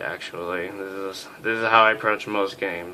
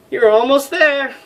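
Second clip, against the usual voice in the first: much higher put-on woman's voice; female voice; 9 dB louder than usual. Flight officer greeting the major, high girly voice. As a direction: squeak it out as a chirpy young woman. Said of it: "female voices".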